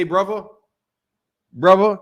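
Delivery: "brother" the British way, with a V for the TH; British "bruvva". Shown as a demonstration. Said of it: "What they say".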